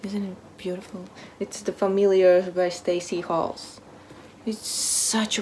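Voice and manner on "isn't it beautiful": usual speaking voice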